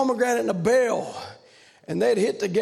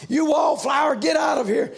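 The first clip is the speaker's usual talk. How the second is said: loud mocking shout at a wallflower; shouted jeer; 7 semitones higher, 4 dB louder than usual. Dancers jeering at a wallflower, loud mocking shout. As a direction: yell it out like the crowd at a dance. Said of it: "All they'd say".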